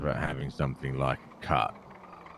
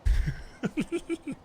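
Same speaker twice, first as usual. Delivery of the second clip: squeaky high laugh rising; high cackle. Real, not acted. Laughing at Brian for falling asleep, a high cackle. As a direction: crack up in a high giggle.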